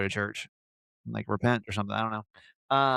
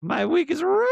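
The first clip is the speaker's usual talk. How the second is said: much higher, louder mock cry of despair; mock wail; 6 dB louder than usual. Host imitating a fan's despair, a much higher, louder mock wail. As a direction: wail it like it's a tragedy, hammed up. Mock anguish over comments.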